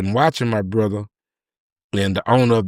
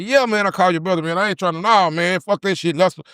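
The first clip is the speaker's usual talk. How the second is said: frantic refusal; 8 semitones higher, faster than usual. Retold or imitated. Friend refuses, panicked.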